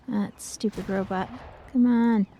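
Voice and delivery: normal talking voice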